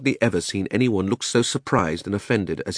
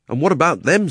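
lightly voiced boy, higher and louder; indignant Diggory; 8 semitones higher, 5 dB louder than usual. Diggory questions his uncle's experiment, indignant. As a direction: challenge him as an indignant nephew.

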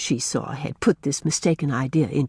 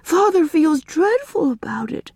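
put-on high, urgent child's plea; pleading girl, higher voice; passionate plea; 10 semitones higher, 3 dB louder than usual. A narrator's reading. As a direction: press the point with feeling. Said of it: "Una cried passionately".

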